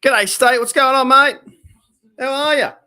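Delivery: high, loud cheery hail; excited greeting. Real, not acted. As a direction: call out a big hello to a mate. Greets fellow creator joining.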